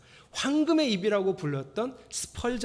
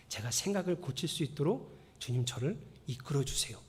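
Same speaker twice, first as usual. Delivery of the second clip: soft, humble lowered prayer; right way to pray; 2 semitones lower, 5 dB quieter than usual. Shown as a demonstration. Pastor shows the right prayer, soft and humble. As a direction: pray quietly and humbly, going softer. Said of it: "이렇게 기도하세요".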